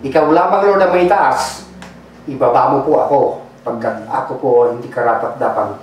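low, soft and hushed prayer voice. Acted. Pastor praying over the congregation, hushed and low. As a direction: pray softly over the church. Pastor prays before the sermon.

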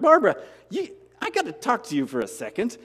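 sudden high call of her name, then settles; calling her over, eager; excited call to Barbara. Retold or imitated. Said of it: "I'm like".

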